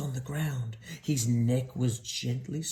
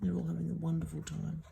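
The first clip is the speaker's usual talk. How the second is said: whispered; 4 semitones higher, 6 dB quieter, faster than usual. A narrator's reading. Pitying aside to child.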